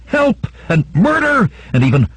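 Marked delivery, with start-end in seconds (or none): 0.1-0.5 high-pitched cry of alarm; cry for help. A narrator's reading. Victim crying out through the house, high-pitched cry. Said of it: "till cries of". 0.9-1.5 high-pitched alarm call; cry of alarm. A narrator's reading. Household raising alarm down the corridors, high-pitched cry. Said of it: "till cries of".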